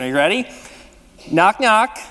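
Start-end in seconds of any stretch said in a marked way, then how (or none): 1.3-2.0 bright, raised call to the room; playful joke opener, raised; called-out knock knock. Pretend. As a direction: call it out so everyone answers. Leading congregation in knock-knock joke.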